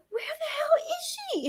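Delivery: high, tearful cry; mother asking her son, crying out high; crying shout. Real, not acted. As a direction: cry it out high with relief and panic. Mother demands where child is.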